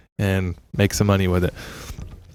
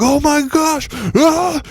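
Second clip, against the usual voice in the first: excited screaming crowd; slower than usual. Retold or imitated. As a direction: squeal it like a thrilled moviegoer. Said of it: "people were like".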